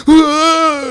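high, startled yelp of panic; mock panic yelp; panicked cry. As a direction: let out a sudden frightened cry. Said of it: "I'm like".

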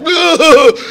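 high loud ugh of panic; a short high yelp of horror; mock disgusted groan. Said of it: "I'm like".

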